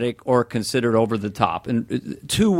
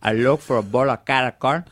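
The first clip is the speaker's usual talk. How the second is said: put-on Tony Montana Cuban accent, complaining; Scarface Cuban accent. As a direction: Cuban accent griping about candy. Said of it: "that accent saying cara corn".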